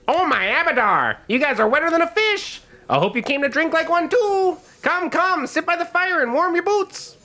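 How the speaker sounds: excitable, very high gnome voice; Gilly Gillerson. Acted. Gnome innkeeper greets soaked guests, in a high excited voice. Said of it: "Gilly Gillerson greets you".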